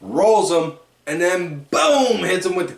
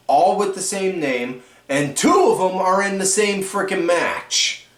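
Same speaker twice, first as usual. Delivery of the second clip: usual speaking voice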